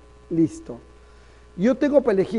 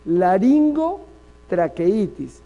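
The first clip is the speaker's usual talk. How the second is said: slow spelled-out; 3 dB louder, much slower than usual. Shown as a demonstration. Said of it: "¿Ya ven?".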